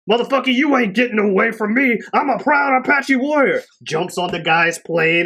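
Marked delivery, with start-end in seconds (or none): 0.1-3.6 high, loud hammed-up hero boast; hammed-up brave boast, pitched up; mock tough warrior. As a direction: bellow it like a cocky hero. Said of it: "Thunderbird's like".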